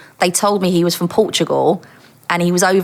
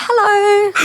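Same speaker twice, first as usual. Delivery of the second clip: much higher, breathy-free coy hello; flustered flirty greeting; 3 dB louder than usual. Retold or imitated. Greeting the handsome officer she fancied, coy and high. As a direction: play it coy and swooning for the handsome man.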